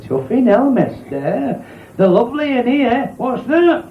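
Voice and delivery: high, amazed woman's voice; a northern woman marvelling at the cab; wide-eyed woman. Acted. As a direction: play her gobsmacked and high.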